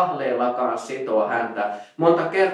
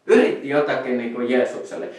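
usual speaking voice

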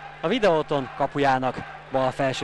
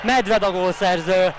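excited goal call; 5 semitones higher than usual. Real, not acted. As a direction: shout the goal out with joy. Live commentary of an equalising goal.

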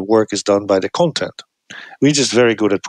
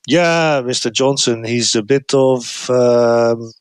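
hesitant insult; 2 semitones higher, slower than usual. Shown as a demonstration. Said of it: "delay before they say something negative".

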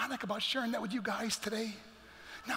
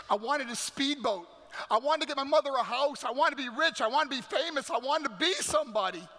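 impassioned list, rising higher and louder; mounting, forceful longing; building intensity; 6 semitones higher, 5 dB louder than usual. Real, not acted. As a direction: pile up each wish harder, driving to the last. Builds list of lost dreams.

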